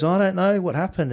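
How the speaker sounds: put-on a high girlish voice, lost at the roadside; flustered woman. Retelling the stranded driver's answer, high helpless voice. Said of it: "and she goes".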